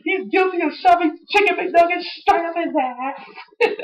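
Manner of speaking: squeaky high cartoon voice; The Rock impression. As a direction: play the accuser in a silly squeak. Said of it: "He's like".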